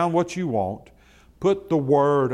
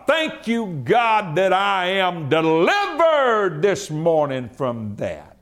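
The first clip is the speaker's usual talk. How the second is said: higher, louder, driving preacher's cry; full-force preaching; 7 semitones higher, 3 dB louder than usual. Acted. Pastor urging his congregation on health, preaching hard.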